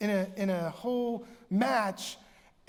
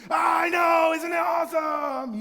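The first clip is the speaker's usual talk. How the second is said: excited fan; 6 semitones higher, 7 dB louder than usual. Retold or imitated. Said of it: "so other people see that".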